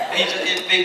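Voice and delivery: normal talking voice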